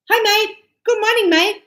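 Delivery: high, loud matey hello; overly casual greeting. Retold or imitated. Greeting customers too casually, high and loud. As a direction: chirp it too familiar. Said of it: "you go".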